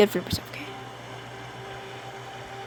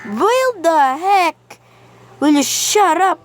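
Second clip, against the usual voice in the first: mock-annoyed yell; 6 semitones higher than usual. Real, not acted. Yelling at noisy game bird.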